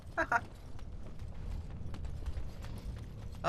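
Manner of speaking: quiet self-amused chuckle; soft chuckle. Real, not acted. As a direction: laugh softly at your own slip. Reacts to missing the tunnel.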